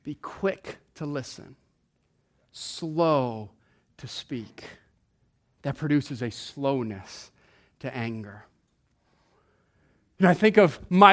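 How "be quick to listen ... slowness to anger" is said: much softer, lower, dropping to a whisper; hushed slow reading. Shown as a demonstration. Preacher softly reciting the verse to the congregation. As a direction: let the verse sink in quietly. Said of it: "James says".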